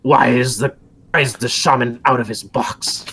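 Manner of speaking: loud, gruff guard calling down; pole-arm guard. Acted. Guard scolds the gobos below, loud commanding voice. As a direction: bark orders from the parapet. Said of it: "the man with the pole arm".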